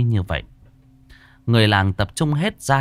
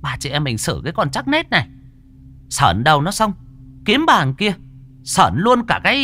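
high, loud, hissed threat from a wife; hissing threat; 8 semitones higher, 4 dB louder than usual. Acted. Wife hissing at her husband's lover, high and loud. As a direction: spit the words out with venom. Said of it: "nói như rít".

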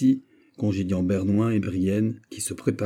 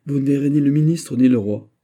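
louder, higher irritated retort; 6 semitones higher, 7 dB louder than usual. A narrator's reading. Mazarin losing patience with Athos, irritated retort. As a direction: cut him off in rising anger. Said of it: "qui commença à s'irriter".

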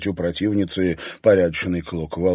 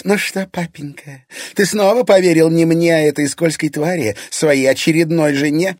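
high mocking challenge, swelling louder; defiant taunt; 6 semitones higher, 4 dB louder than usual. A narrator's reading. Irina taunting father for believing his wife, defiant taunt. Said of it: "Ирина вызывающе улыбнулась".

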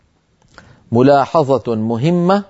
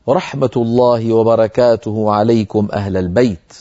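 Quran recitation; 4 semitones lower than usual. Acted. Teacher quotes the verse in recitation tone.

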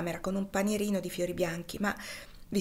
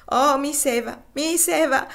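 high, loud, frantic wail; wild sobbing cry; 7 semitones higher, 8 dB louder than usual. Acted. Topsy sobs over Eva's deathbed, a wild, frantic wail. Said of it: "qualcosa di selvaggio e di forsennato".

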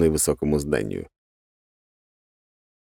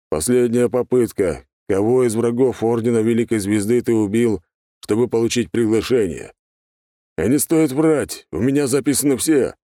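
gruff thug voice; 4 semitones higher, 3 dB louder than usual. A narrator's reading. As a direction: press him for the truth. Thug questioner voice. (угрожающий голос охранника)

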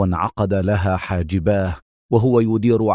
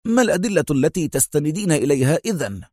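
quick, higher-pitched firm demand; firm interruption; 6 semitones higher, faster than usual. A narrator's reading. Consul interrupting Mona to demand evidence, firmly. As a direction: cut in firmly and fast. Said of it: "قاطعها في حزم".

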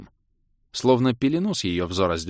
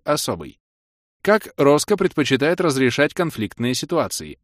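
размеренный официальный голос автоинформатора, чуть приподнятый; объявляй как игровая система; системное объявление; 5 semitones higher, 4 dB louder than usual. Acted. (официальное системное сообщение)

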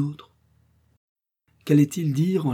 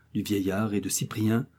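usual speaking voice

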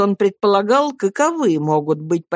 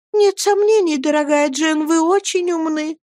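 very high-pitched conciliatory woman; placating mother; 10 semitones higher than usual. Acted. Mother assures dear Jane she is clever, high and placating. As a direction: soothe her in a high voice.

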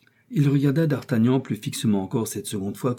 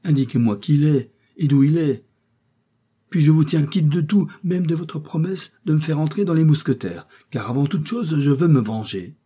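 higher, louder vow of revenge; young man begging, then swearing revenge, in a raised voice; exclaimed; 6 semitones higher, 4 dB louder than usual. A narrator's reading. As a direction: cry it out, hungry for vengeance. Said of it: "s'écria d'Artagnan".

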